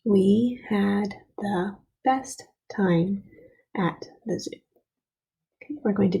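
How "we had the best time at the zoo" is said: slow, word-by-word read-along; spaced-out words for a class to follow; reading together. Shown as a demonstration. Teacher reads the sentence back together with pupils, slowly. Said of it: "go back and read it together".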